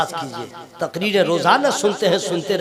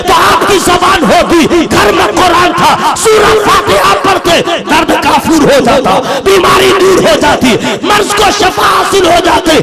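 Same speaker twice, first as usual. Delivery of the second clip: raised, ringing sermon voice building over each line; full-force preaching; 8 semitones higher, 10 dB louder than usual. Real, not acted. Preacher rousing the congregation, full-force preaching. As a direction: preach it out to the crowd.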